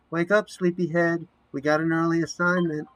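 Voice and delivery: chirpy rousing of a sleepy roommate; cheery wake-up call. Acted. Bonnie wakes sleeping Amanda.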